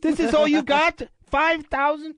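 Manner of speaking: high mock Irish brogue, laughing at the end; Conor McGregor impression. Host mocking the small bag of cash, in a high Irish voice. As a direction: scoff in a put-on Irish voice. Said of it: "And Conor's like".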